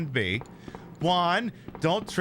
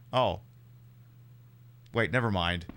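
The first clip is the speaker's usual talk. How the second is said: normal talking voice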